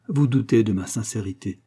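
ordinary delivery

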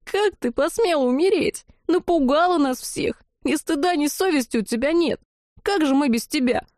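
high, sobbing reproach; tearful scolding. A narrator's reading. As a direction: scold her through relieved tears. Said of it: "Рыдая в голос ... провыла Лалина".

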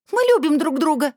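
high, loud desperate cry; teen screaming her love in defiance; shouting. Acted. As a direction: scream it at your aunt. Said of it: "закричала Анжелика".

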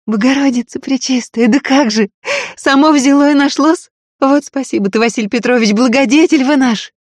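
high, loud outburst of joy; relieved joy. Acted. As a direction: cry out in sheer relief. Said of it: "взрыв облегчённых, радостных голосов".

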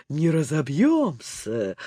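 low, slow, unbothered character voice; confident reply. A narrator's reading. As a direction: brush off the fear with calm assurance. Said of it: "уверенно отвечала Лола".

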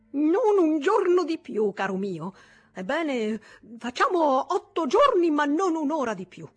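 bang the table with the deadline; loud insistence. Acted. Kesselmeyer insisting loudly. (ultimatum forte e acuto)

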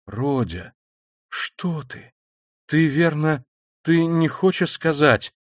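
hushed start, rising in pitch; alarmed, halting half-question; frightened. A narrator's reading. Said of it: "начала было в испуге". (испуганно, с запинкой)

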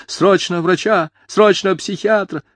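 high, drawn-out plea; frantic urgency. A narrator's reading. Mother demanding a doctor for her son, frantic. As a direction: call for help in high alarm.

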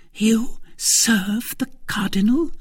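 high, slow incredulous question; shocked disbelief. Acted. Wife learning whom her husband serves, shocked. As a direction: draw out the question, aghast.

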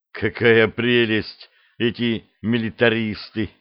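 ordinary delivery

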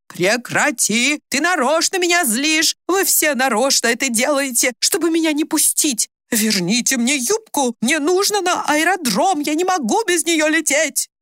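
high, drawn-out, hysterical demands; agitated yelling. A narrator's reading. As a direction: wail in protest, pitch soaring. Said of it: "Мать перешла на крик".

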